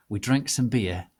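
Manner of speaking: usual speaking voice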